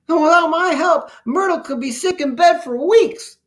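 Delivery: very high-pitched little germ voice; Pox the earnest germ. A narrator's reading. Germ warns the immune army, high and urgent. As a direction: warn them in a high cartoon voice.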